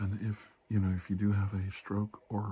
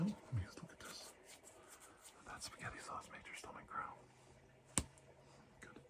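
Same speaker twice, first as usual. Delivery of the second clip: soft unvoiced whisper close in; 4 semitones higher, 15 dB quieter than usual. Doctor whispering while examining the patient, hushed whisper. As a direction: breathe it right into the mic.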